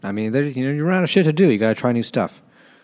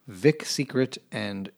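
ordinary talk, nothing marked

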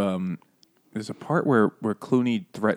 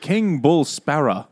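higher-pitched mock Jamaican accent; 4 semitones higher, 3 dB louder, slower than usual. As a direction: put on a playful Jamaican lilt. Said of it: "They are Jamaican".

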